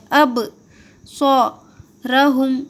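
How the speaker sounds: اب سو رہم in separate parts, thick "so" and "ro"; heavy, full-mouthed. Shown as a demonstration. Teacher showing Saad unlike Seen, heavy and full-mouthed. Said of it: "موٹا پڑھنا ہے منہ بھر کے".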